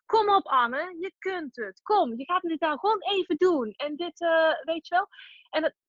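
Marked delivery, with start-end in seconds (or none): 0.1-3.7 higher-pitched self-coaching voice; pushy inner voice. Acted. Inner voice pushing her to get on with it, higher and insistent. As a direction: act out the nagging little voice egging yourself on. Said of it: "zo'n stemmetje in mij dat dan zegt".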